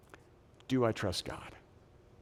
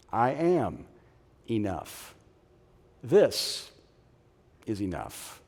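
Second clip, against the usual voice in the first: normal talking voice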